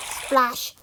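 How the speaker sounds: usual speaking voice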